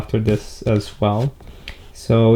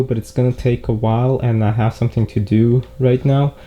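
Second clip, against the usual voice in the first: normal talking voice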